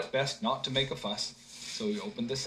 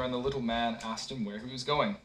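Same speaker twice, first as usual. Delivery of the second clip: ordinary delivery, nothing marked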